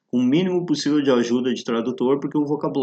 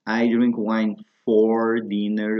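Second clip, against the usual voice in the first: slow model phrase; much slower than usual. Shown as a demonstration. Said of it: "nas outras expressões".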